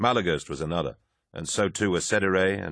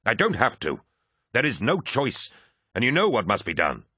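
insistent Abaddon; 5 semitones higher than usual. A narrator's reading. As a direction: refuse to back down. Abaddon arguing back at Horus.

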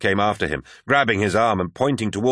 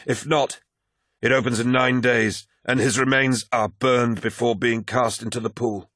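higher-pitched, measured character voice; solemn Malachhurst; 5 semitones higher than usual. Acted. Malachhurst explains the Delphos gate to Loken, solemn. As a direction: explain the ritual gravely, pitched up.

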